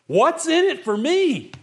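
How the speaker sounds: high, slow self-centered whine; selfish whine. Retold or imitated. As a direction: sound grabby and self-serving. Selfish attitude quoted.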